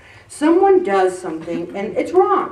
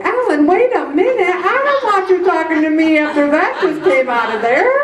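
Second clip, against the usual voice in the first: Mary; 6 semitones higher, 4 dB louder than usual. Acted. Voices Mary scolding her.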